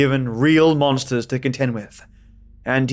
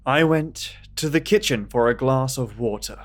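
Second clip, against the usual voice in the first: plain reading voice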